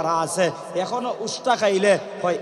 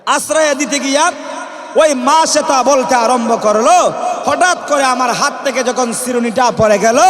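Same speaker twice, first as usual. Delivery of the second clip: loud, raised sermon narration; full-force preaching; 5 semitones higher, 7 dB louder than usual. Real, not acted. Preacher telling of the maid's dropped comb, loud rousing preaching. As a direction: drive the story home to a big congregation.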